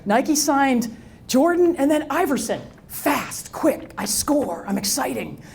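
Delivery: slow, deliberate pitch to the bosses; arguing to executives why signing Shaq is wrong; pointed argument. Retold or imitated. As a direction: lay out each point slowly. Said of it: "I said".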